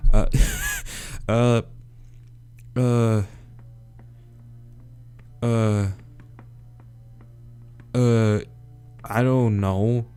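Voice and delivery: ordinary delivery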